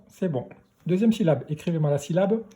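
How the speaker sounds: usual speaking voice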